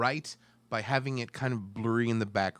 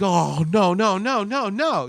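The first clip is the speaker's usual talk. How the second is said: yelling; 6 semitones higher, 8 dB louder than usual. Retold or imitated. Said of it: "I'm, like, yelling".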